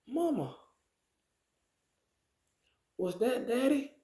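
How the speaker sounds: put-on high, hopeful little girl asking her mother; small child pipes up with a question; a daughter asking her mama about the pilot's voice; little girl's voice.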